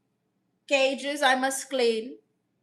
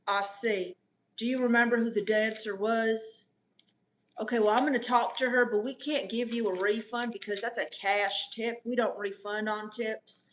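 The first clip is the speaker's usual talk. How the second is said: put-on American voice; 2 semitones lower, 3 dB quieter than usual. Owner posing as an American manager, a lightly put-on American accent. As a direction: pose as an American manager named Barry. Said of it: "pretend that I am someone different".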